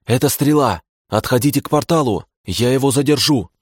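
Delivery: urgent radio order. Acted. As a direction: call the order over comms. (срочный приказ по связи, повыше)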